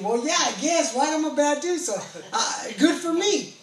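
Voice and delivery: loud, high ironic shrug; mock agreement about being a bad dude; wry self-mockery. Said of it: "I was thinking".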